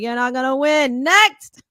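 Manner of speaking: imitating the DJ calling the next contestant; dismissive DJ. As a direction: brush off the loser. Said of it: "He's like".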